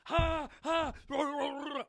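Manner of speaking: put-on high, loud childish shout of protest; mock toddler tantrum at the table; imitating a child. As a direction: ham up a kid yelling at dinner. Child voiced refusing food.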